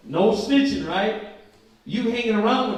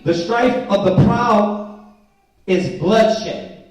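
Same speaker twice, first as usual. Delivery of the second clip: scripture reading; 6 dB louder, much slower than usual. Acted. Reads verse fifteen.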